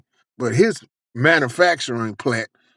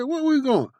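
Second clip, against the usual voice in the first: surprised; 11 semitones higher, 3 dB quieter than usual. Retold or imitated. Surprised question.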